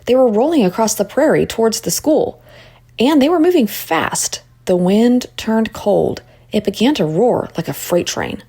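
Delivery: ordinary delivery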